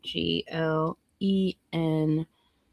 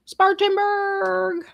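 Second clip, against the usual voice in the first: Spartanburg sung out slow and much higher; sing-song; 12 semitones higher, much slower than usual. Shown as a demonstration. Streamer repeating the town name, high sing-song. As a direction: lift Spartanburg way up, slow and playful. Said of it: "repeated higher, sing-song".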